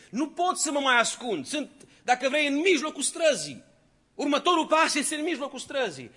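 desperate plea. Acted. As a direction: cry out in shame and panic.

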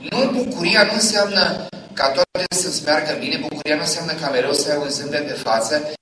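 impassioned preacher building; fervent preaching. Real, not acted. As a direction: drive the point home to the crowd. Preaching climax.

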